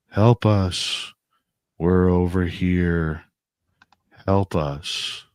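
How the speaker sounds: slow, flat man's voice calling; hollow, lifeless plea, lightly voiced; man's emotionless voice. A man's voice pops up in the woods, flat monotone. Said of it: "Like, there's no emotion".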